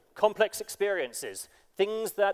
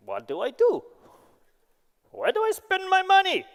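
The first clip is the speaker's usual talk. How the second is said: mock Russian accent of a rich man with too many yachts. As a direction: play the bored Russian oligarch in a Russian accent. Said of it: "can I do the accent?".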